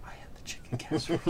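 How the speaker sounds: hushed whisper. Real, not acted. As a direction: whisper it guiltily. Whispered confession at the table.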